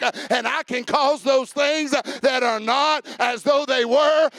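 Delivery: rising, loud preacher's cadence that builds; full-force preaching. Acted. Preacher climbing again over music.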